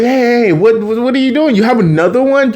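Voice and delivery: high, hurried questioning; retold startled challenge. Carly catches them with another one, startled.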